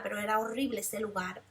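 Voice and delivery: normal talking voice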